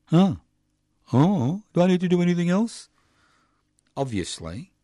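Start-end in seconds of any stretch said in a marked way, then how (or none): 0.1-2.9 high, put-on whine; mocking whiny reply. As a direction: play the clueless one, pitched up high.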